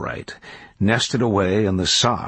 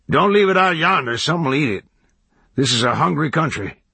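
put-on starts raised, country old-timer; hermit warning; 8 semitones higher, 3 dB louder than usual. A narrator's reading. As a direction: warn the kid like a backwoods hermit. Hermit warns about saddle.

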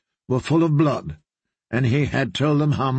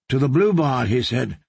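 ordinary delivery, nothing marked

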